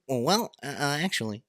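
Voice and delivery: high-pitched mock pedant; nerd impression. Showing co-host how every nerd sounds, hammed-up high nerd voice. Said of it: "Every nerd sounds the same".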